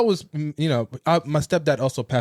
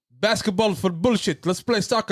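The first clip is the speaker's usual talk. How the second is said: higher, imitating a thick Somali accent; 7 semitones higher than usual. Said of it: "very thick Middle Eastern Somali accent".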